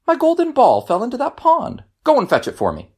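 high, demanding princess voice; spoiled royal giving orders; bossy princess. A narrator's reading. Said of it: "Surprised, the princess responded".

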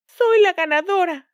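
high, loud gloating cry; triumphant shout. A narrator's reading. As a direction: crow about winning. Madrastra grita triunfante. (grito triunfal)

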